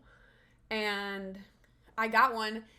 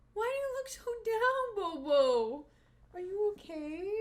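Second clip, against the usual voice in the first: much higher, cooing pet voice; 10 semitones higher than usual. Real, not acted. Owner cooing to her droopy dog, high baby talk. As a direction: coo at the sad puppy. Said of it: "same words, baby voice".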